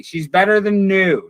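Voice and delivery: ordinary delivery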